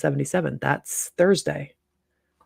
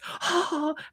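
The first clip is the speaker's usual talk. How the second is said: mock gasp; 11 semitones higher, 4 dB quieter than usual. Mock gasp after jeer.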